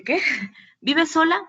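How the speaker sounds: shocked question. Retold or imitated. Doctor shocked by patient's answer.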